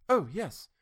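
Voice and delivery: quick, high, sudden; quick answer. A narrator's reading. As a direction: snap it out quickly. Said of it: "The answer came quick".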